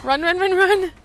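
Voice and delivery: high, loud rising panic; panicked yelling. Real, not acted. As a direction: shout urgently at a fleeing friend. Urging teammate to flee.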